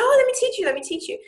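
eager offer. Retold or imitated. Waitress eagerly offering to teach her. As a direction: insist eagerly.

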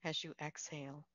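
soft, unhurried meditation voice with long pauses; guided meditation. Real, not acted. Leads a Yoga Nidra relaxation.

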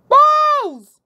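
high, loud panicked scream. Acted. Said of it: "screamed Pent".

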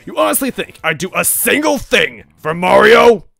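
huge furious roar, high and loud; outraged villain's thunderous shout; booming. A narrator's reading. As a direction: bellow it across a crowded club. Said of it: "His voice boomed through the club".

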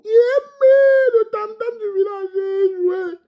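high wailing shout, drawn out slowly; hammed-up high cry; mock proclamation. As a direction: belt it like a village crier. Proclaiming himself village drum.

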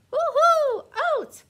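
high, loud delighted whoop; squealing with joy over oats; excited cheer. Acted. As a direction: cheer like it's the best news ever. Horse cheering about eating oats.

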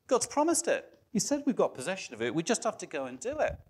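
high-pitched, eager voice for Caleb. Retold or imitated. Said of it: "Caleb's like".

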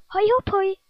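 hoge luide vreugdekreet; vrolijk kraaien. Acted. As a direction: kraai het blij uit. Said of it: "Erik kraait vrolijk". (Erik kraait vrolijk: hoog en luid)

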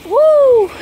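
loud, high whoop of excitement; excited whoop. Real, not acted. Owner cheering as his dog goes for the ball, loud whoop. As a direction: cheer like the dog just scored.

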